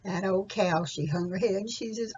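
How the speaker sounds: ordinary delivery, nothing marked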